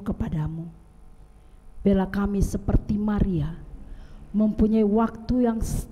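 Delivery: steady, earnest prayer voice; closing prayer. Acted. Pastor leading the congregation in closing prayer, reverent.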